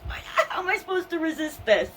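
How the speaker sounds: high, giggly gush over a pet. Real, not acted. Gushing over the cat.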